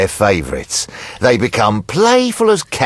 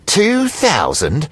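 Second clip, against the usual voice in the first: slow, drawn-out disbelief, rising at the end; incredulous; 4 semitones higher, slower than usual. Acted. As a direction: question the sum as if insulted. Creditor scoffs at the offered sum.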